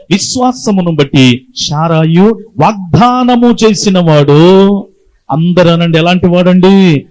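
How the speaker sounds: loud, slow, rising sermon call; preaching at full force. Real, not acted. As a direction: drive it home to the congregation. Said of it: "preaching at full force".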